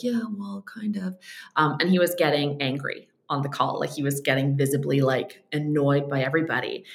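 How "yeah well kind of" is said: sheepish mumble. Retold or imitated. Sheepish group members answering Dan.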